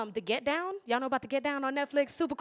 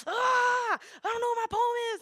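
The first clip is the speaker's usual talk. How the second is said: retold pillow scream; 12 semitones higher than usual. Poet retells losing their poem, high mock scream. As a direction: wail it high, acting out a meltdown. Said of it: "I was screaming into the pillow".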